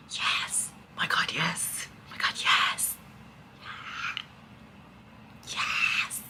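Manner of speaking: breathless whispered yeses; whispered delight. Real, not acted. Reader reacting alone to the book's ending, whispered delight. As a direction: whisper it in rapturous disbelief.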